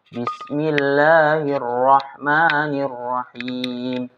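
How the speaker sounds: slow, chanted recitation; Quran recitation. Shown as a demonstration. Teacher showing how we read the Quran, chanted. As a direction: recite it slowly like reading the Quran.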